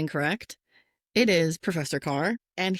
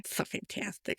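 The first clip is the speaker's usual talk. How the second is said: whisper; 10 dB quieter than usual. Real, not acted. Whispered gush.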